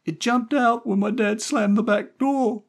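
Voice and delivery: put-on very high, loud child's voice, drawn out; little boy. A narrator's reading. Stevie explaining the empty trunk to Jerry, little boy. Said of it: "Stevie explained".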